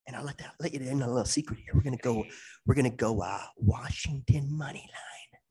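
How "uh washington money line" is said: secretive whispered tip. Acted. Co-host leaking a betting tip to the audience, whispered. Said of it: "Why is he whispering it".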